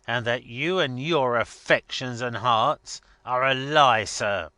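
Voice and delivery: loud, emphatic tirade; indignant master laying down the law; blustering Boffin. Acted. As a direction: hammer the accusation home.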